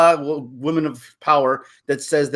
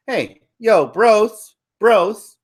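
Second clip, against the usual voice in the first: higher mocking girl voice; mock woman's voice; 6 semitones higher than usual. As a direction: parody her brushing off the bros. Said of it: "does she say to those guys".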